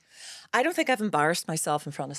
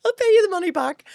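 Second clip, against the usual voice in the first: giggling, high and breaking into laughter; a jokey sorry through laughs; laughing mock apology; 4 dB louder than usual. Mock apology to Africa down the camera, laughing. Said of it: "apologise to Africa down this camera".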